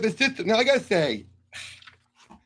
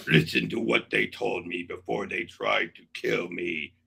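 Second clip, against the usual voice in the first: very low, slow brute voice; slow, deep monster menace; Hulk voice; 12 semitones lower, 6 dB quieter, slower than usual. Pretend. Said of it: "may I read Hulk's message".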